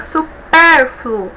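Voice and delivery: su-PÉR-fluo, PÉR long and strong; stress on penultimate. Shown as a demonstration. Teacher showing the strongest syllable of supérfluo, stress on penultimate. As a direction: punch and stretch PÉR in supérfluo. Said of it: "A sílaba mais forte".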